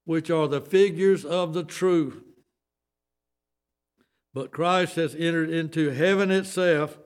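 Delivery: sermon-pitch scripture reading; preaching scripture. Acted. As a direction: proclaim the verse to the congregation. Reads scripture at preaching force.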